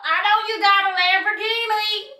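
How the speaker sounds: high put-on accent on the Lamborghini line; mock accent. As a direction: say it like a different person's accent. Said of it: "I don't know where the accent came from".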